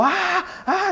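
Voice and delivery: high mock wails of a scared child; imitated child crying. Father imitating his son's frightened crying at night. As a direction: cry out like a frightened little boy. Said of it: "escucho a mi hijo llorando".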